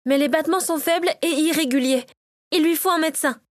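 urgent assessment. A narrator's reading. Natacha warns the others about a weak pulse, urgent. Cook's wife assessing an unconscious man.

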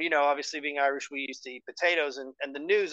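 normal talking voice

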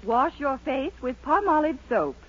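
ordinary talk

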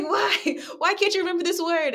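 imitating her own harsh inner critic asking why she can't remember a word; self-scolding inner voice. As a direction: scold yourself like an impatient teacher. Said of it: "I beat up on myself a lot".

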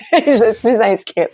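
slow and laughing through the words; amused, laughing at her own impulsiveness. Real, not acted. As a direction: let the laugh carry it, slowly. Laughing at her own quick signup.

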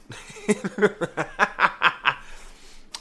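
breathy laugh bursting out; can't hold the laugh in; burst of laughter. Real, not acted. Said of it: "I'm not gonna laugh no more".

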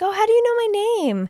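high, fast mock girl voice; surprised girl. Friend voicing Tanya surprised Charles knows her name. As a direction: gasp it out quick and high.

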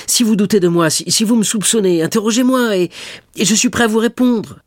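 very high, loud, halting plea; desperate cry. A narrator's reading. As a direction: cry out, begging to be questioned. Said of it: "s'écria le malheureux jeune homme". (supplication criée, désespérée)

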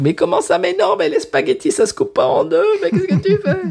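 voix très aiguë, outrée, à l'italienne; chef italien outré. Retold or imitated. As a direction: imiter un chef italien scandalisé. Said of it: "très haut en couleur". (chef italien excédé par une recette ratée, voix perchée)

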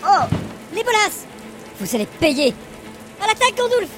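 squealed battle cry. Acted. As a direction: shriek the charge. Elf charging into battle. (cri de charge suraigu)

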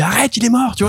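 high, desperate plea; pleading. Retold or imitated. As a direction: beg them to stop, voice jumping high. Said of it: "on lui dit".

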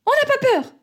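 put-on very high-pitched children's cry; all crying out. A narrator's reading. Children answer papa's doubt, crying out. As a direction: cry out like defiant kids. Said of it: "On crie tous".

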